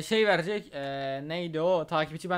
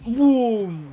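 loud mock explosion boom; a hammed-up loud boom; loud boom effect; 5 semitones higher, 9 dB louder than usual. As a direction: shout boom on the impact. Sound effect for a slam.

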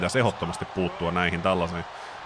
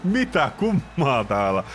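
much higher, slow, incredulous; stunned high commentary; 8 semitones higher, slower than usual. Acted. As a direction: drag it out high as if you can't believe it. Stunned commentary of MVP leaving.

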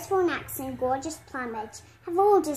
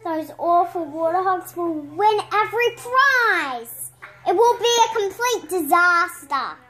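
dismayed; 4 semitones higher, 6 dB louder than usual. A narrator's reading. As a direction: wail it like a catastrophe. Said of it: "they cry in dismay".

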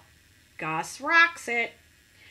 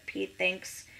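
ordinary delivery

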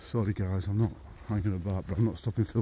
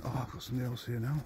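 ordinary talk

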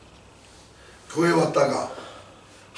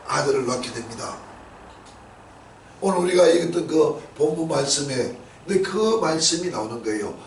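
usual speaking voice

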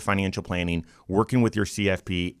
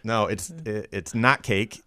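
ordinary talk, nothing marked

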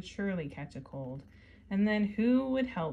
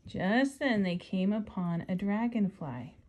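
story narration; 6 dB louder than usual. Acted. Reading picture book aloud.

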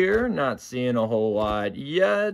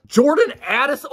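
excited squeak; 11 semitones higher, 5 dB louder than usual. Real, not acted. Opening a card pack, squeaking with excitement. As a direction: voice jumps up into a squeak. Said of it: "Oh, I squeaked".

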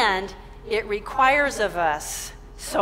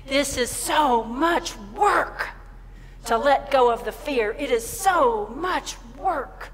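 raised pitch, heavy stretched emphasis on each word; acted overwhelmed complaint; exasperated protest; 4 semitones higher, slower than usual. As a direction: plead how hard it is, drawn out. Voicing Jesus' weary protest in prayer.

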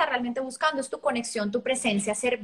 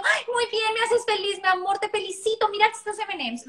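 much higher sugary praise; gushing praise; 7 semitones higher than usual. Retold or imitated. Parent rewarding child with candy, mock gushing. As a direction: lay it on thick, candy in hand. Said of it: "en lugar de yo decirle al niño".

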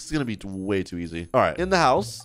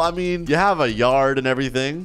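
normal talking voice

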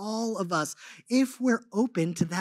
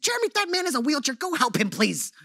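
imitating his wife, much higher and fast; mock insistent wife, higher up; wife's higher voice; 6 semitones higher than usual. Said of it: "she's usually my higher voice anyway".

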